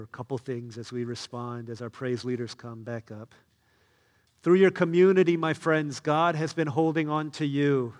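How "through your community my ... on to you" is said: louder, higher impassioned appeal; rousing pulpit cry; fervent preaching. Acted. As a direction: preach it with full force. Prayer at full force.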